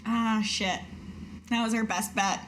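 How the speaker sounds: ordinary delivery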